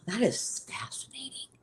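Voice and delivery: a soft, breathy whisper of wonder. Real, not acted. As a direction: whisper it to yourself, amazed. Whispered aside while showing books.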